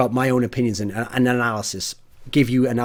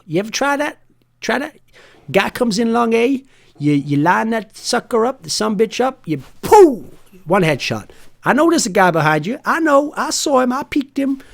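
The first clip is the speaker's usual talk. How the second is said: hammed-up Red Deck accent; 9 semitones higher than usual. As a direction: ham it up like a yokel coach bragging. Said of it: "This is terrible".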